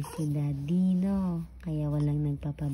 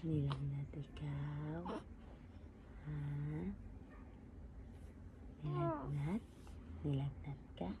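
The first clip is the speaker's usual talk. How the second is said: hushed murmur; 15 dB quieter than usual. Real, not acted. Mother soothing sick baby.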